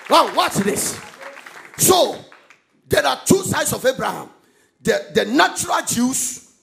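loud, emphatic scripture proclamation; full-force preaching. Real, not acted. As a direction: drive each phrase out to the congregation, loudly.

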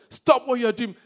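very loud command; forceful, acted order from the crowd; bystanders' rebuke. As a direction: shout it to cut someone off. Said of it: "When people around him were saying".

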